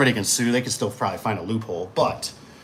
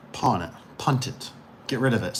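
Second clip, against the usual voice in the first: normal talking voice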